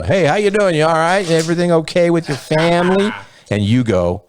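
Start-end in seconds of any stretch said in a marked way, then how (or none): 0.0-3.1 high, chirpy fake-polite greeting; fake friendly. Fake-nice greeting to someone who angered you. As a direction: sound sweet while you're seething.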